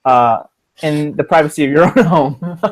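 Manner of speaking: ordinary delivery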